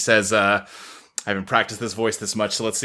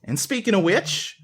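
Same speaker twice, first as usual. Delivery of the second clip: higher, brisk, chummy boss voice; rally the servants like a cheery new boss; new head butler; 9 semitones higher than usual. Acted. Joanna addresses the servants and guests, higher and upbeat.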